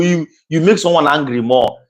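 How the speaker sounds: normal talking voice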